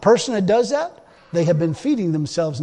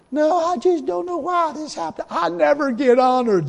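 higher, self-pitying whine; whiner; 7 semitones higher than usual. Retold or imitated. A complainer moaning that he is never honored, in a whine. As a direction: moan like nothing ever goes right. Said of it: "It doesn't say".

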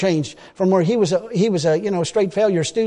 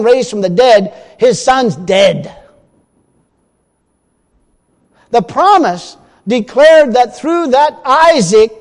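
loud, driving pulpit preaching, slowing on key lines; preaching at full force; 2 semitones higher, 6 dB louder than usual. Acted. Preacher to congregation on Abraham's promise, loud full-force preaching. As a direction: hammer the promise home to the congregation.

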